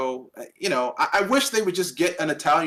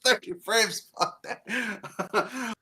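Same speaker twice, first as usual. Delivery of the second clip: laughing; 7 semitones higher, much slower than usual. Real, not acted. Laughing at his own joke.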